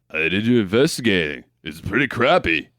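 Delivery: low action-hero mumble; deadpan low tough-guy impression; John Rambo. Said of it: "John's just gonna go in there and be like".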